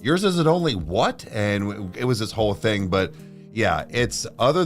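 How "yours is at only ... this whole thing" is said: ordinary talk, nothing marked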